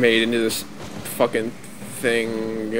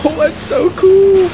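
amazed gamer; 13 semitones higher, 6 dB louder, slower than usual. Real, not acted. Awed at a weapon effect.

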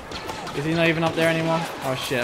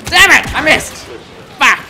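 furious high yell at a miss; angry shout; 11 semitones higher, 11 dB louder than usual. Real, not acted. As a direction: yell it out in frustration. Missed the shot in game.

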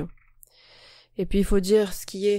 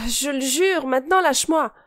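high, louder, pleading on the edge of tears; close to tears; 6 semitones higher, 3 dB louder than usual. Acted. Girl to the boy holding her wrist, close to tears. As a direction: beg him to let go, nearly crying. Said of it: "dis-je au bord des larmes".

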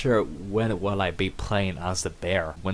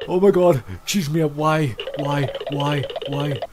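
high, loud desperate pleading; frantic panic; 7 semitones higher, 5 dB louder than usual. Real, not acted. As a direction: beg for help while fleeing. Chased by bear, begging for help.

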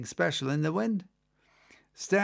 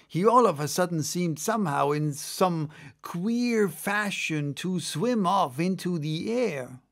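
ordinary delivery, nothing marked

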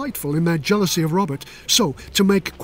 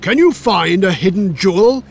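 louder royal demand; demanding king; 6 dB louder than usual. A narrator's reading. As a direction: press him like a king. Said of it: "he demanded".

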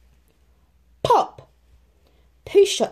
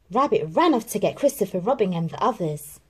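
usual speaking voice

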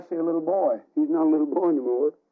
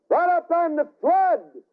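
emphatic preaching; 14 semitones higher, 4 dB louder than usual. Real, not acted.

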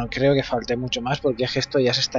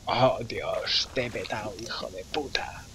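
quiet gloating; 5 semitones lower, 10 dB quieter than usual. Pretend.

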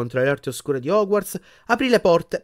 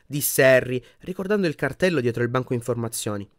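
ordinary delivery, nothing marked

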